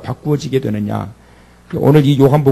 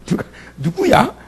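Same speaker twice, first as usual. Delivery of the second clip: laughing mock startle; 10 semitones higher than usual. Congregant startled by voice.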